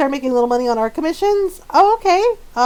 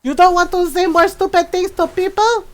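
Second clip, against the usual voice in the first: high, taunting mom voice; playful imitation of mom's challenge; imitated Filipino mom; 7 semitones higher, 5 dB louder than usual. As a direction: call them back like a feisty mother. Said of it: "she's like".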